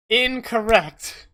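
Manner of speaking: emphatic contradiction. Real, not acted. Game master overturning his own claim, emphatic and drawn-out. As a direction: stretch it out like a big ruling.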